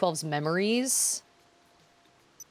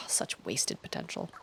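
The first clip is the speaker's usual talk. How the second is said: sighed, hushed regret; whispered acted disappointment; wistful whisper; 9 semitones lower, 8 dB quieter than usual. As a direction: let it out softly on a sigh. Sighing game character line.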